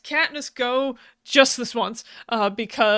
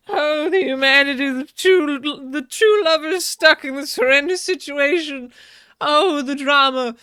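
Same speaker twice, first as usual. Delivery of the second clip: higher, louder, hammed-up lament; mock melodrama; 4 semitones higher, 8 dB louder than usual. Recapper mocking the TV romance for ratings, hammed-up melodrama. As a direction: play up the fake tragedy for the cameras.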